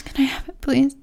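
usual speaking voice

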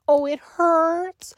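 high, slow mocking whine. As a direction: parrot the complaint back sarcastically. Mocking partner's complaint.

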